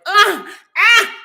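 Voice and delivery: high, loud fake pain cries; mock pained gasps. Faking pain while hobbling to the CEO, mock pained gasps. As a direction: ham up the pain. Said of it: "acting like I was in so much pain".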